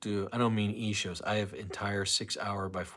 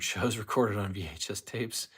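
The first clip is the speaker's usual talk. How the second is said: usual speaking voice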